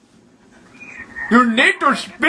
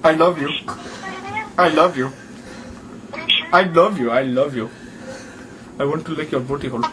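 low, crooning mock declaration of love; hammed-up low-voiced sweet talk; mock tender; 9 semitones lower, 4 dB quieter than usual. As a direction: woo the listener in a low put-on accent. Mock wooing of the call's listener.

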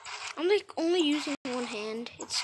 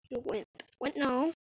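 usual speaking voice

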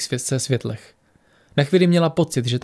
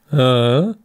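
completely lost, rising "huh?"; baffled grunt; 3 dB louder than usual. A narrator's reading. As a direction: let out a confused, questioning noise. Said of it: "hlesl úplně ztracený Ang".

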